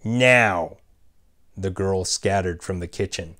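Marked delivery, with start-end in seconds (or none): 0.0-0.8 barked order. A narrator's reading. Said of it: "Clara barked".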